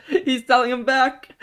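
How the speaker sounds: high, loud cry of delight; laughing outburst. Real, not acted. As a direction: shriek it through laughter. Gleeful outburst at joke.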